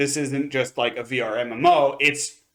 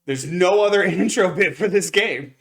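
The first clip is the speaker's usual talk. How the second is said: laughing; 4 semitones higher, 4 dB louder than usual. Real, not acted. Laughing while describing intro.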